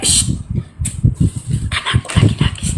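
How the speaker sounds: soft breathy mother whisper, fast; hushed mock mother whisper. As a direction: whisper so the baby sleeps. Mother hushes crying baby.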